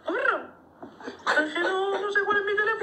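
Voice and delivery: like Mickey Mouse; man doing a woman's voice. Pretend. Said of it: "parece Mickey Mouse".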